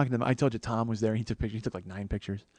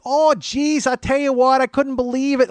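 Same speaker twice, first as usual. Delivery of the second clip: loud, high Wisconsin woman impression; Wisconsin accent; 12 semitones higher, 7 dB louder than usual. Old schoolmate exclaiming to comic, very Wisconsin accent. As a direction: exaggerate a very Wisconsin accent, pitched way up. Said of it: "she's like, very Wisconsin".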